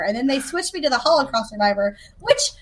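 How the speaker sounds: ordinary talk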